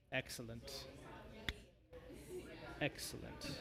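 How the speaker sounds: hushed, whispery gloat; soft sinister devil whisper; gloating bone devil. Acted. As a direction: breathe it out very softly, savouring it. Bone devil gloats over signature.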